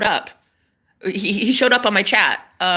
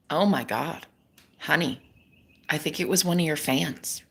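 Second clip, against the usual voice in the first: soft, low voice breaking bad news; gentle pity; 5 semitones lower, 8 dB quieter than usual. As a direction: imitate him letting someone down softly. Retold crush breaking news gently.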